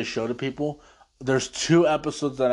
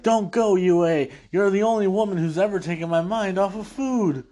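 acting Sokka; 8 semitones higher than usual. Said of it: "Sokka's like".

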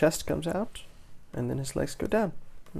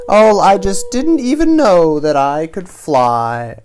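loud, drawn-out amazement; elated fish marvelling at itself; joyful shout; 5 semitones higher, 16 dB louder, slower than usual. A narrator's reading. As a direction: holler it slowly, starting high. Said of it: "she shouts".

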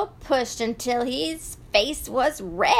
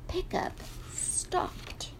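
fading whisper; 8 semitones higher, 13 dB quieter, slower than usual. A narrator's reading. Truck dies.

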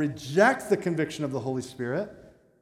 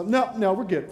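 prideful brush-off; 5 semitones higher, 6 dB louder than usual. Retold or imitated. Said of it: "I was so prideful".

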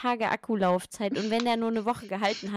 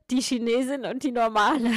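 laughing; 2 semitones higher, 4 dB louder than usual. Real, not acted. Laughing at her own remark.

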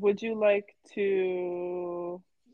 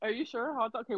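ordinary talk, nothing marked

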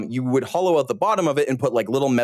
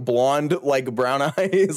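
normal talking voice

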